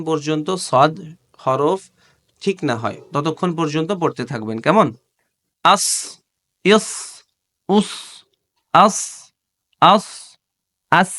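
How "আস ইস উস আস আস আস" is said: আস said with a flat, spread tongue like sin; showing the thin letter; thin sin demo. Shown as a demonstration. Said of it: "এটা কিন্তু সিন হইছে".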